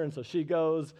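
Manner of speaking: ordinary talk, nothing marked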